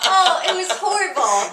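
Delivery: ordinary delivery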